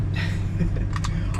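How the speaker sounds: a short airless chuckle; breathy laugh. Real, not acted. Laughs at his own ranting.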